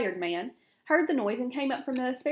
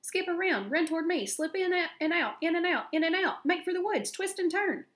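high-pitched, excited coaching; high, insistent storybook goose; urgent goose orders; 7 semitones higher than usual. A narrator's reading. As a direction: rattle off commands as a frantic goose. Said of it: "began to give orders".

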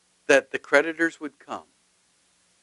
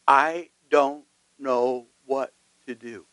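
slow, softer desperate plea; desperate cry; 4 dB quieter, slower than usual. Retold or imitated. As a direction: let "I don't know what to do" sink slowly, hopeless. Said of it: "have cried out to God and said".

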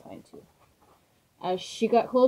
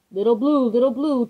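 loud, eager little train calling; piping up; 4 semitones higher, 8 dB louder than usual. A narrator's reading. Youngest train calls to his friend, loud and eager. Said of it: "piped up".

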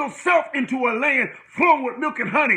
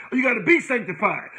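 ordinary talk

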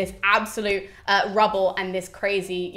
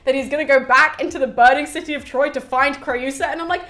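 ordinary delivery

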